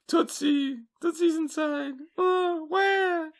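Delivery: very high put-on child's wail; hammed-up high-pitched cry, not full volume; screaming kid. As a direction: imitate a kid crying out for a dog. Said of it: "just screaming the dog's name".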